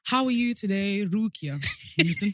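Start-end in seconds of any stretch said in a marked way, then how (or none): 1.1-2.3 slow, stiff robotic reading of "Rukia Newton"; smart speaker voice. Retold or imitated. Imitating her speaker saying the friend's name in robotic voice. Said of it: "That's how my speaker says your name".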